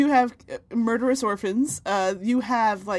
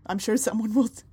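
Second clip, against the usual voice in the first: ordinary talk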